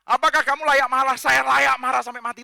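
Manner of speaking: loud, high-pitched angry retort; angry at God. Acted. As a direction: snap back at God in outrage. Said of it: "Tuhan aja dimarahin".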